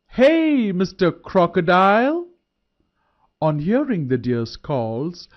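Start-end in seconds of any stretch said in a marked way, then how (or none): 0.1-0.7 very high, loud hailing call; shouted hey. A narrator's reading. Deer hailing the crocodile from the bank, very high shout. 0.7-2.3 shouted call. A narrator's reading. Deer calling the crocodile a second time, loud rising shout.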